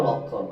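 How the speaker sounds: লক্ষণ said as lok-khon; silent ম. Shown as a demonstration. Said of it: "ফলা থাকলে তার উচ্চারণ হয় না".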